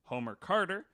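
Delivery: normal talking voice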